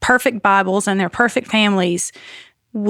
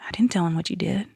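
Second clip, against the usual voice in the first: whispered denial; 8 dB quieter, faster than usual. Retold or imitated. Husband whispers back in church.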